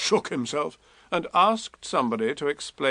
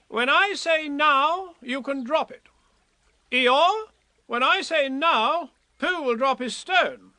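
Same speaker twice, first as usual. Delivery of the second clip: higher, bossy little Rabbit voice; Rabbit taking command; 12 semitones higher than usual. A narrator's reading. Rabbit ordering Pooh and Eeyore at the bridge, high and bossy. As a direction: give orders like a fussy organiser.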